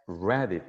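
"read it" as one unit, reh-dit; linked words. Shown as a demonstration. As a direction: slide the d of "read" into "it". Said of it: "따로따로 발음하는 게 아니라".

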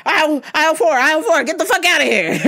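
high, loud laughing dismissal; shooing away. Retold or imitated. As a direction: wave him off, half laughing.

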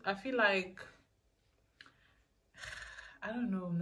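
ordinary delivery

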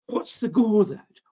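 put-on a curious boy's "go" in broad Scots; Scottish accent. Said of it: "my Scottish accent is from the f-, northeast, not from the southwest".

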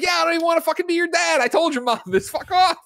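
high, fast, hammed-up yelling father; mock angry dad. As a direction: blow up at the daughter, half joking. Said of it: "And he's like".

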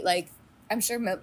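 usual speaking voice

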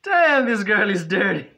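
slow, loud, drawn-out words through laughter; laughing disbelief. Real, not acted. Reacting to the movie scene with loud, slow, laughing disbelief. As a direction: marvel at it while cracking up.

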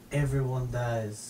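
low, slow French deadpan; blasé French accent, dragging it out. Acted. French operator answering a dying caller, very French. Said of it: "in the most French way".

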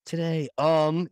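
"um" drawn out long after "today"; projected filler "um". Shown as a demonstration. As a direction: push the "um" out, slow and rising. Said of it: "people really project on that, um".

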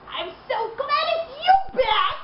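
a lightly voiced blubbering Joker; mock sobbing Joker. A narrator's reading. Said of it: "Joker sobbed".

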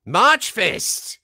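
put-on high, loud German-accented shout; German character cheer. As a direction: proclaim it like a festive German character. German character cheer, corrected month.

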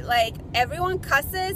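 normal talking voice

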